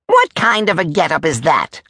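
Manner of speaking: high, scornful demand; indignant Major. Acted. Major eyeing the soldier's outfit, high and indignant. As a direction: question the outfit in high outrage.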